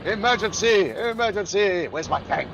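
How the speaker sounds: high, loud emergency call; mock alarm shouting. Gamer yells for his tank as enemies break through, mock alarm. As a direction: raise the alarm like a battle commander.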